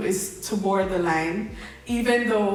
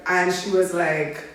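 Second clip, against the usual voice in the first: ordinary talk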